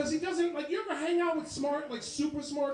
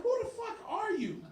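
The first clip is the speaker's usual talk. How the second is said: high incredulous outburst; 10 semitones higher than usual. Retold or imitated. Dad exasperated.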